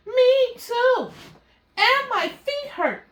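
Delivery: a tiny tired kitten moaning about sore feet; complaining kitten. Acted.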